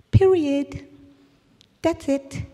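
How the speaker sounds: Period. said high with a long falling end; high, drawn-out period. Shown as a demonstration. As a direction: land Period. high and let it fall.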